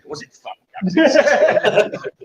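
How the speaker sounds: slow, giggling through the words; laughing question. Real, not acted. Host cracks up mocking the name, laughing. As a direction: laugh while you ask it.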